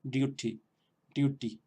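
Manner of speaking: "duty" with a retroflex d, unaspirated; light mock Indian English "duty"; Indian accent imitation. Teacher imitating Raj's Indian accent on "duty". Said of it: "imitando a un acento indio sería duty".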